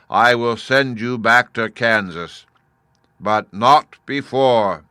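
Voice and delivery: booming, slow, commanding head of Oz; loud, solemn wizard head laying down the terms; great head of Oz. Acted. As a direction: loom over the girl like a giant talking head.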